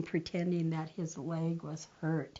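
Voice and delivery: usual speaking voice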